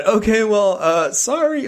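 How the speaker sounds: high-pitched, sheepish sorry; awkward apology. Retold or imitated. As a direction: retell a taken-aback, tentative apology. Retold awkward apology to snarling guest.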